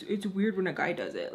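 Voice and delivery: normal talking voice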